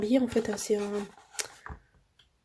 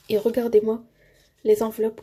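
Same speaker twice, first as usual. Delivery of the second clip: ordinary talk, nothing marked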